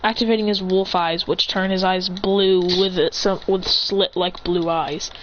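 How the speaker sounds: ordinary delivery, nothing marked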